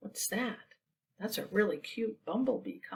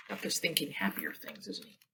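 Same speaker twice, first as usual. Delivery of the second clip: whispered aside; 4 semitones lower, 7 dB quieter than usual. Real, not acted. Reader whispering an aside to the listening children. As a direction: lean in and whisper it.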